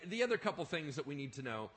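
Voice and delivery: ordinary delivery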